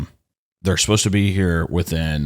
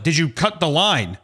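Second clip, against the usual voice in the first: higher-pitched retold interrogation; frustrated questioning; 6 semitones higher than usual. Customer questioning the man who trenched his fiber, frustrated. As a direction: press the question in mock exasperation. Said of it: "So I'm frustrated".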